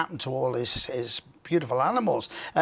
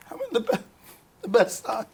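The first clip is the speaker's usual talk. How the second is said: laughing; 6 semitones higher than usual. Real, not acted. Laughing while echoing host.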